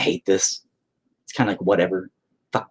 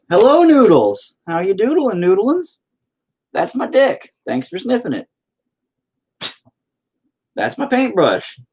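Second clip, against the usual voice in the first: pet voice; 13 semitones higher, 4 dB louder than usual. Real, not acted. Talking to the pet cat.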